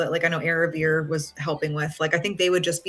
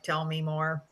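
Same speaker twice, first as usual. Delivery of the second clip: therapist; 8 dB quieter than usual. Retold or imitated. Stereotype therapist voice.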